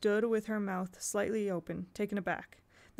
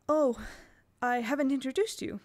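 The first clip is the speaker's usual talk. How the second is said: higher, chirpy hostess voice; bright; 5 semitones higher, 5 dB louder than usual. A narrator's reading. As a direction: sound brightly sociable. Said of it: "Miss Withers said brightly".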